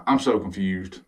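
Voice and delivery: a touch of pretend defeat; mock confused. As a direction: sound lost and worn out, half joking. Sighs, gives up on logic.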